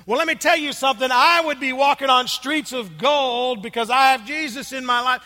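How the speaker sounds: loud, high, insistent preaching at a stranger; super loud. Retold or imitated. Street preacher pressing his faith on a stranger, super loud. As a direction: lean in and bellow it at him. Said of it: "he's being super loud".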